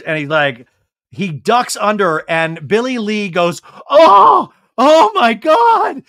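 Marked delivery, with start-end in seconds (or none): 3.9-6.0 loud, high mock shriek; hammed-up ecstatic cry; vocalized awe. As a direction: wail it out slowly, way over the top. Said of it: "I'm vocalizing because her".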